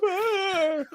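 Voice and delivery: very high-pitched fake crying wail; hammed-up falsetto sob; mock wail. Same acted crying figure.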